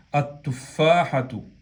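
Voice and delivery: "At-tufahatu" slow, held t replacing the L; fused lam. Shown as a demonstration. Teacher repeating the right form, lam fused into t. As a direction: slowly model "At-tufahatu", no L at all. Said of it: "se pronuncia at-tufahatu".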